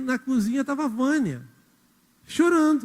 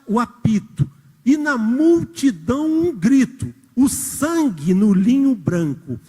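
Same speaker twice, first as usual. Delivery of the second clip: slow, louder, solemn reading of the verse; poem recitation; 2 semitones lower, 5 dB louder, slower than usual. Acted. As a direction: declaim it like a poem from the pulpit. (declamação lenta e solene)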